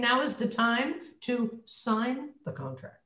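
slow, soft statement falling on "contract"; soft downswing. Shown as a demonstration. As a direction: step down gently to the end of "sign the contract". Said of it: "My volume got kinda soft".

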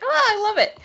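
giddy fangirl delight; gushing. Retold or imitated. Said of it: "I'm like".

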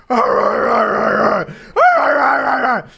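string of high, loud mock growls; growling. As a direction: growl over and over like a beast mid-fight. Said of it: "he's just growling".

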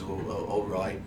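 soft, mildly nervous agreement; nervous gnome. Acted. Gnome giving in to the wizard, mildly nervous. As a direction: give in quietly and hesitantly. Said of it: "looks around nervously and says".

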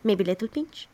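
a sneaky little crab's offer; quiet sly crab. Retold or imitated. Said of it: "He's like".

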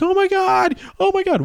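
high, shocked mock gasp of the bar girls. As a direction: imitate horrified girls at the bar. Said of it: "all the girls were like".

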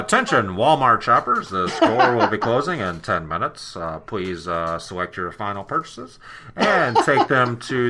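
store PA announcer voice; lightly voiced closing-time announcement; supermarket announcement. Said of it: "they called it Walmart".

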